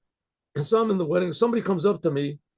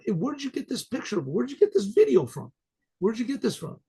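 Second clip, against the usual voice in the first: high, eager demand, re-enacted; astonished questioning; 5 semitones higher than usual. Asking the man where he got the video, astonished. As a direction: ask in amazement, pitch jumping up. Asks stranger about the video.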